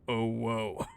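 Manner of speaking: mock tough-guy drawl, stretched out; hint of put-on tough guy. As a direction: lightly mimic a tough guy's silly moan. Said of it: "put on a tough guy voice".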